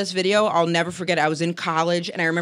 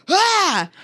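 startled yell; 12 semitones higher, 4 dB louder than usual. Retold or imitated. Horrified at the video.

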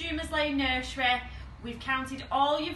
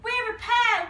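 high, loud, thrilled exclamation; excited; 11 semitones higher, 6 dB louder than usual. Acted. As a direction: burst out with joy. Simon thrilled at finding partner.

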